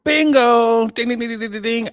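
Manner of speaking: loud, high game-show bingo; excited. Real, not acted. Cheering his co-host's right answer, loud and high. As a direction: ring the winner bell.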